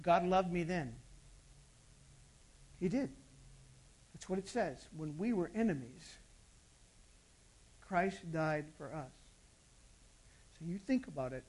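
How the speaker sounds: soft altar-call voice to a quiet church; hushed preaching. Real, not acted. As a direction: draw the listeners in close, gently.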